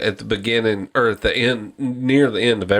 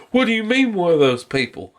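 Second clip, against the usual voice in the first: higher-pitched mock indignant question; offended woman; 7 semitones higher than usual. Woman turning on the fisherman, offended retort.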